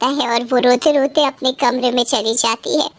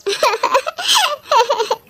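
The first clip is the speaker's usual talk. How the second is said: high mean giggle at her cousin; mocking laugh; 10 semitones higher than usual. Mocking laugh after insult. (ऊँची ताने वाली हँसी)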